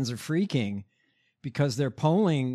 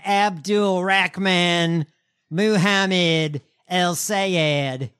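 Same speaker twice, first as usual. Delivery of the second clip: slow, drawn-out mispronounced name; mocking opponents; 3 semitones higher, 5 dB louder, much slower than usual. Co-host imitating opponents saying candidate's name, mocking. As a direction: stretch out each name like a hostile attacker. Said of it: "they're out there saying".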